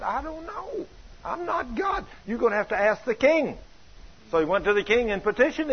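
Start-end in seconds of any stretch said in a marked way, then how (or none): 0.0-3.6 exasperated high reply. Retold or imitated.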